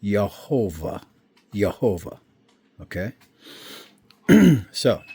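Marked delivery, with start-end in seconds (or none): 0.0-2.2 Yehovah starting with a Y, stressed long "HO"; Hebrew pronunciation. Shown as a demonstration. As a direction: say Yehovah as "yeh-HO-vah". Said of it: "that's pronounced Yehovah".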